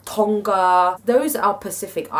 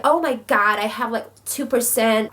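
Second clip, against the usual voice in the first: put-on American accent; 4 semitones higher than usual. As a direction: put on an American accent, mimicking amazed DNA reviewers. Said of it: "I do American accent".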